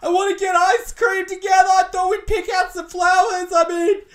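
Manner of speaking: hammed-up high, loud wail; mock emotional outburst. Shown as a demonstration. Woman wrongly confronting her boyfriend, hammed-up emotional outburst. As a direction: plead hysterically in an exaggerated high voice. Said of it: "you don't get emotional. You don't say".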